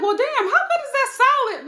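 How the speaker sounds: high-pitched, loud mock amazement; incredulous outburst. Host recalling her reaction to a husband eating salad, incredulous. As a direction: exclaim it high, laughing into the end.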